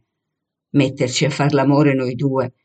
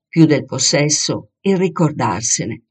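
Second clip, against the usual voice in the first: usual speaking voice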